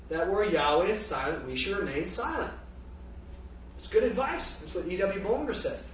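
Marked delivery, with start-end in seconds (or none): none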